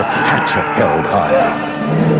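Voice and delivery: usual speaking voice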